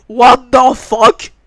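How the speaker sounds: very loud, very slow stretched shout; startled shout. Real, not acted. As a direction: bellow in shock.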